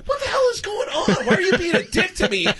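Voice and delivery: very high, whining mock outcry; exasperated protest. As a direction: protest in a squeal, hamming up the bewilderment. Retold protest at being yelled at.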